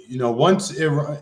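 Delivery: normal talking voice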